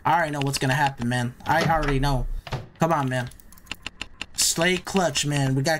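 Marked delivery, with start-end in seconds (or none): none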